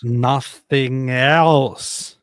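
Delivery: deliberate, weighty repetition; slow emphatic insistence. Real, not acted. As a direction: hammer it home very slowly. Insisting nothing else matters.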